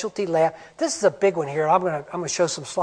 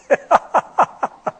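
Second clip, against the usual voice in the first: real laugh; 8 semitones higher, 4 dB louder than usual. Real, not acted. Laughing after sarcasm.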